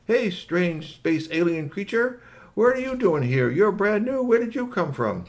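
high, eager dog character voice; talking dog. Acted. Maxie questions the space alien, high and eager. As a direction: quiz the newcomer in a high doggy voice. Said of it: "And Maxie was saying".

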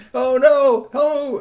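high, loud panicked oh no; alarmed cry. Acted. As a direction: yelp in panic. Squirrels alarmed.

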